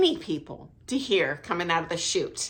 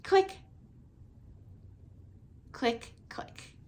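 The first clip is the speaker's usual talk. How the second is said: clicking sound with the teeth; tongue click for the clave beat; 4 semitones higher, 11 dB quieter, slower than usual. Shown as a demonstration. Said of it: "click my teeth".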